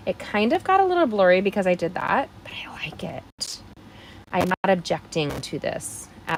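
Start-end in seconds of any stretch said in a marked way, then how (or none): 2.4-3.6 soft whispered afterthought; whispered aside. Real, not acted. Crafter whispering to viewers about the blurry stamp, whispered. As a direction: breathe it as a little secret.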